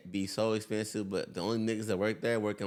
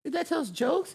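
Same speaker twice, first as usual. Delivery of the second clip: much higher, disbelieving parent voice; incredulous question. Retold or imitated. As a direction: question it like a baffled parent volunteer. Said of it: "the little parent volunteers be like".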